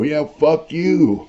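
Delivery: drawn-out, high-pitched mock retort; stretched-out taunting jab; retold taunting comeback. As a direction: throw the insult back, slow and high. Acted comeback in retold quarrel.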